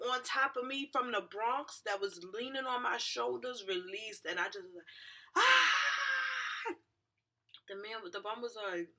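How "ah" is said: high, sustained re-enacted wail; crying scream. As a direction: let out a long, high cry. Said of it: "I just started yelling and crying".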